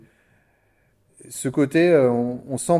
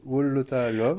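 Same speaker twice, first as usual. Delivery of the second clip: French-accented English. Real, not acted. Host admits her bad accent, French-accented English title. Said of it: "mon accent est terrible".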